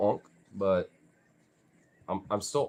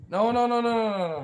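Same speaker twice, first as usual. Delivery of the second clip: rapid string of high, louder no's; urgent protest; 12 semitones higher, 6 dB louder than usual. Real, not acted. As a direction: stop the dog with high, insistent repeats. Stopping the dog.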